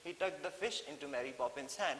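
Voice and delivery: ordinary talk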